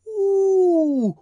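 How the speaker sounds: high, loud wondering ooh; awed ooh. A narrator's reading. Crowd gasping at the opened cash box, awed. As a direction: gasp in delight like at fireworks. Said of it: "as though they were looking at fireworks".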